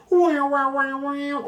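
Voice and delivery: high, quick string of wows; excited wow. Real, not acted. Collector delighted he owns the movie, high excited wows. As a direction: gush with delight at the find. Excited about owning laserdisc.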